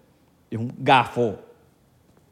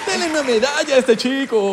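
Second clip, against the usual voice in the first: mock praise; 11 semitones higher, 3 dB louder than usual. Mock praise after cheering.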